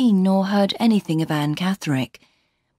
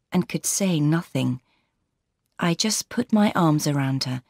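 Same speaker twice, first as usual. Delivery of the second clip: usual speaking voice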